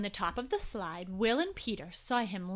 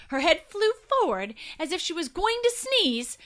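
plain reading voice

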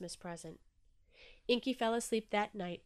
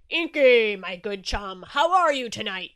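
big booming shark host, louder; loud, jovial party host at the door; hearty Shark; 13 dB louder than usual. Acted. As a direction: boom out a jolly welcome. Shark greets Inky at door.

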